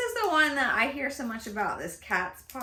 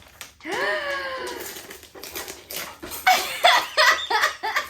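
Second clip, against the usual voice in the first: loud, high, laughing gasp of joy; a thrilled gasp that breaks into a squeal; gasp with a shout; 13 semitones higher, 6 dB louder than usual. Real, not acted. Wife opens the brushes she wanted, a loud joyful gasp.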